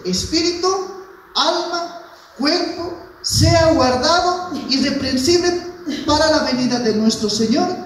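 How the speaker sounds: high, loud reading in separated phrases; emphatic scripture reading. Acted. Preacher reading Thessalonians to the congregation, proclaimed scripture. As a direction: proclaim the verse, pitched high and forceful.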